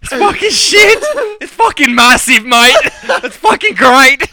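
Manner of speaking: high, excitable mock Australian; hammed-up Aussie surfer hype. Aussie surfer raving to his mates, hammed-up Australian accent.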